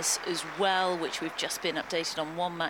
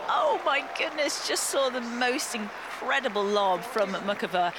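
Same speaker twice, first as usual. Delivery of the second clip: bursting out, louder and higher on oh my goodness; thrilled live call of a brilliant shot; excited; 6 semitones higher, 5 dB louder than usual. Real, not acted. Commentator calls Muchova's incredible lob to viewers, excited.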